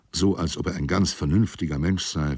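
ordinary delivery, nothing marked

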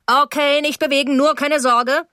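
high, loud rescuer's call; thundering voice. A narrator's reading. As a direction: take charge at full, booming volume. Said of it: "mit donnernder Stimme".

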